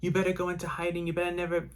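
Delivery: sustained high retold shouting; retold screamed threat. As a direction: imitate rescuers screaming a warning, volume held back. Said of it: "the people were screaming".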